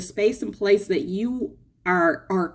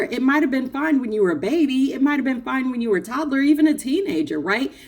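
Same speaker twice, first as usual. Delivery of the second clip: ordinary talk, nothing marked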